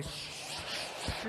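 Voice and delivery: soft, repeated "shh"; soothing shush. Retold or imitated. Man being her rock, shushing her softly. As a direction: hush them gently, breath only. Soothing his hysterical girlfriend.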